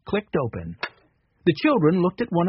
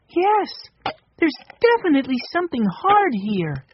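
put-on higher, eager girl's voice; a girl confirming a find, pitched up; lightly voiced excited girl; 11 semitones higher than usual. A narrator's reading. Narrator voicing Violet.

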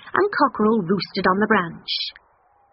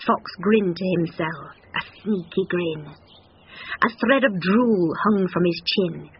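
usual speaking voice

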